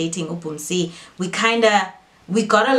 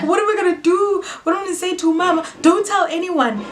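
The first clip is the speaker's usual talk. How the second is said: panicked worry; 11 semitones higher than usual. Retold or imitated. Sister worried about telling mama, high panic. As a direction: plead in a panic. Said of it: "It's like".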